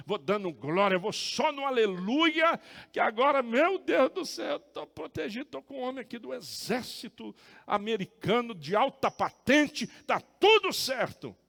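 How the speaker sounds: loud, high crowing with relief; exultant boasting. Retold or imitated. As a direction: rejoice as if nothing can go wrong. Exulting in his imagined safe trip. (exultante, em voz alta e aguda)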